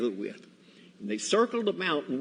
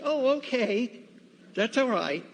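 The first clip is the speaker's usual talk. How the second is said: easy acceptance; 12 semitones higher, 3 dB louder than usual. Retold or imitated. Guard accepts the explanation.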